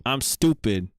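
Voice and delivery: usual speaking voice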